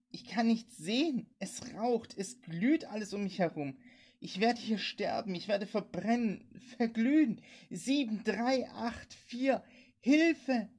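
high, panicked cries, building to a loud scream for help; woman terrified of burning alive; desperate, near tears. A narrator's reading. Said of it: "man hörte sie schluchzen und weinen".

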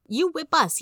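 put-on high-pitched small child's outcry; mock little-boy yell. A narrator's reading. Little brother accusing his brother, mock yelling. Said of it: "yelled Benny".

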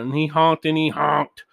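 ordinary delivery

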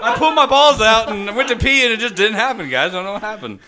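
high-pitched whiny imitation; mock bafflement. Imitating Sean explaining his accident, mock bafflement. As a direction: imitate a clueless friend up high. Mocking Sean's baffled confession.

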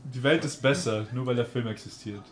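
ordinary talk

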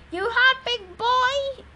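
high-pitched mock-sweet taunt. A narrator's reading. Trucker taunts a caged lion.

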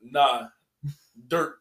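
ordinary delivery

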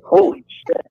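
high-pitched, stunned exclamation; retold shock. Driver watching her kick his new car, high shocked exclamation. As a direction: act out being shocked, pitch leaping up. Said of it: "I was so shocked".

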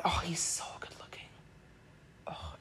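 hushed, whispered gush; barely voiced, smitten aside; swooning whisper. Real, not acted. Swooning over her crush.

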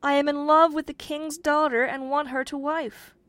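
high, loud declaration of love; ardent cry. A narrator's reading. Young man tells his godfather of his love, crying out. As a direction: cry it out, pitched well up. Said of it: "cried the young man".

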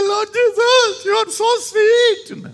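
high, loud, tender crying out; gushing young prayer. Retold or imitated. Young believer crying to Jesus, high gushing prayer. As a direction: pour it out like an emotional young believer. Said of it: "I used to cry".

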